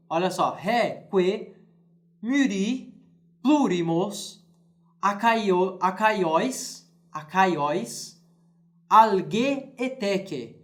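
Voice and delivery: slow word-by-word Latin reading. Shown as a demonstration. Teacher reading Latin words to students, slow and measured.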